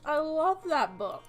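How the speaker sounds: ordinary talk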